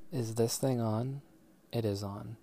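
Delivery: ordinary delivery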